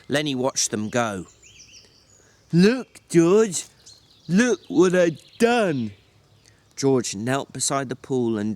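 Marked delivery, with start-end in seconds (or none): 2.5-5.9 put-on loud, high, childlike delight; a big simple man showing off his ripples, excited and loud. A narrator's reading. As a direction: call out like a thrilled child.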